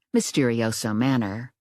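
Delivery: ordinary delivery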